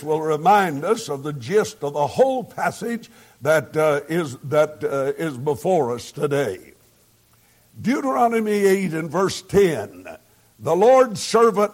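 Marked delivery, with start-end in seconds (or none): none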